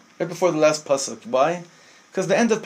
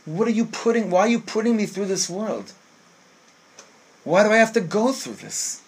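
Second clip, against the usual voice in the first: higher-pitched, exasperated complaint; pleading challenge; 4 semitones higher than usual. Acted. Person asking God about their life, pleading complaint. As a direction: press the question as a plaintive protest. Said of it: "Did anyone ever ask the question".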